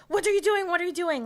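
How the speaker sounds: high, loud guard shouting; retold yelling. Embassy guard tackling her covered in knives, mock yelling. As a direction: imitate an alarmed guard yelling. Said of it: "I just start getting screamed at in Hebrew".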